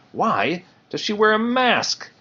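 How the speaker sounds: high, slow curious question. Acted. Bambi asks Thumper about the raccoon, high and curious. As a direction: ask it high and slowly, wide-eyed. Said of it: "very curious".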